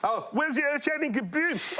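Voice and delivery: peevish cartoon Martian asking; Marvin the Martian voice. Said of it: "I'll give them the whole concert".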